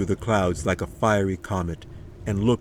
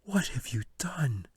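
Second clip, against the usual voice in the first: breathless, unvoiced whisper; a frightened girl's stunned whisper; 5 semitones higher, 5 dB quieter than usual. A narrator's reading. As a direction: hush it, shocked at the sight. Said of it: "then pulled back her hand and whispered".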